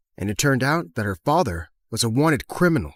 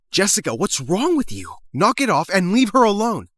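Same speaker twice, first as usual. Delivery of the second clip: higher-pitched angry scolding; indignant; 6 semitones higher than usual. Acted. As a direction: step in and tell the bully to stop. Intervenes against bullying.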